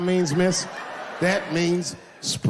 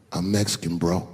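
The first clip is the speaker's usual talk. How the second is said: low, unbothered, casual reply; cool, laid-back; 9 semitones lower than usual. Retold or imitated. Easygoing husband answering the comedian, cool. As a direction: toss it off coolly, pitched low. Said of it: "her husband was just cool about the shit".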